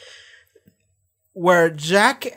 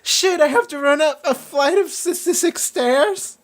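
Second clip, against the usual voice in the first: mock complaint; 10 semitones higher than usual. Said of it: "it's like".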